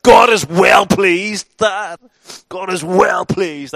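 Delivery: very loud and high, slow gleeful yell; triumphant shout. Retold or imitated. Son shouting at his pastor dad, triumphant and loud. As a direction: shout it back in victory.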